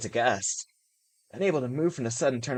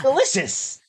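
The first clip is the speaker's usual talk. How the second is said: squeaked reply; 13 semitones higher, 8 dB louder, slower than usual. Acted.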